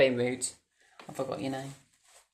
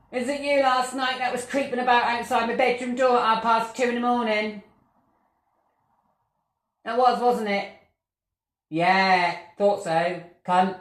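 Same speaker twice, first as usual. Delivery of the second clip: accusing shout; 7 semitones higher, 4 dB louder than usual. Real, not acted. Confronting the ghost.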